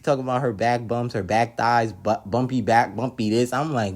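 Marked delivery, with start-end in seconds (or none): none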